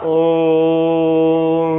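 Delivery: "ओम" sung with the ओ held for over three beats; drawn-out pluta vowel. Shown as a demonstration. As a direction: chant "ओम" slowly, stretching the vowel long. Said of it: "अब ओ शब्द को हमने कितने लंबे तक खींचा".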